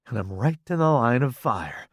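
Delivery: usual speaking voice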